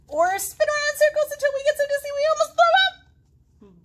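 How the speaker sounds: very high, loud, fast; excited kid. Acted. Girl in the book listing fun things, very high and fast. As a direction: rattle it off breathlessly. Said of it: "girl boasts".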